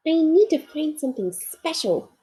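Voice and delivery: a small toy bear fretting to himself; little bear Corduroy. Acted. Corduroy deciding.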